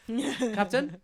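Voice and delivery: high, loud call across the deck; loud hail of captain. Acted. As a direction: call her over. GM voicing captain calling.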